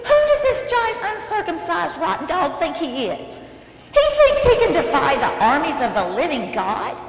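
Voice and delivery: high, loud indignant outburst; so mad. Acted. David mad at the giant defying God, loud outrage. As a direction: fume at the insult, starting very high. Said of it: "that giant made him so mad".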